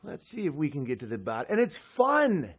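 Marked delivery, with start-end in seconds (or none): 0.0-1.5 low mock calm. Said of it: "And you're like".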